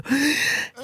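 sharp, exaggerated gasp of dismay; mock horrified gasp. Actor told to use their own accent, mock horrified gasp. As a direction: gasp as if appalled by the request.

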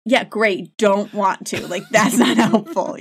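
loud, laughing mock enthusiasm; exasperated sarcasm breaking into a laugh; sarcastic. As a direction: scoff loudly at useless comfort. Sarcastic reply to unhelpful consolation.

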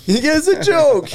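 high voice breaking into laughter; host cracking up mid imitation, laughing high; laughing squeak. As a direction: giggle through a high mock voice. Laughing while mimicking.

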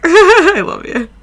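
high, loud laughing affection; really laughing while saying it. Real, not acted. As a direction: draw it out through laughter. Laughing after teasing the co-host.

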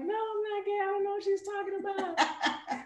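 mock denial. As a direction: squeal out a panicked denial. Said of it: "at first I was like".

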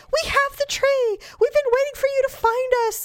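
sing-song, pleased with themselves; smug kids. Retold or imitated. Kids telling mother they waited with the tree. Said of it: "And they're like".

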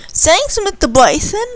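put-on a girl happily thanking the kind visitor. A narrator's reading. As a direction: thank him with childish glee. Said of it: "Candy said happily".

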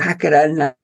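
put-on high, delighted baby voice; baby in the womb. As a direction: ask it like a child who can't stop dancing. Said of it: "And the baby says".

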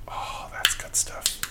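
slow, hushed whisper of relish; savouring whisper. Real, not acted. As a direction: whisper it after a satisfying sip. Savouring a sip of stout.

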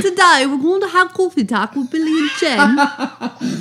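high, laughing British nanny voice; prim nanny welcoming the listeners, in a British accent; a playful British nanny accent, pitched high and half laughing. Acted. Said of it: "You sound like a British nanny".

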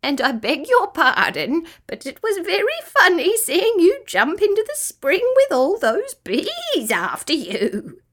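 high, swooping bird voice full of mirth; amused kookaburra. A narrator's reading. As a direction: chortle through the words like a bird. Kookaburra character speaks.

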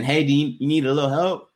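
a hint of a fake-polite helper, quick; mock helpful passer-by. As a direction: toss off a sarcastic offer of help, fast. Mocking what passers-by should say.

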